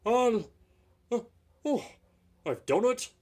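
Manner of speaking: high, loud breathless relief; relieved gasp. Acted. Exhausted engine arriving, gasping with relief. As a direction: gasp out your triumph. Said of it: "he sighed thankfully".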